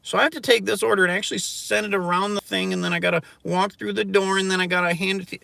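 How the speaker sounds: high, loud exasperated rant; angry rant. Real, not acted. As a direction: vent at the top of your voice. Ranting louder and higher.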